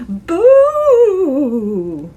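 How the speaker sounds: loud shouted boo; ghostly boo. Shown as a demonstration. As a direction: shout it to scare someone. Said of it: "Let me hear you shout".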